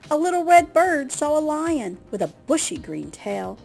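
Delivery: ordinary delivery, nothing marked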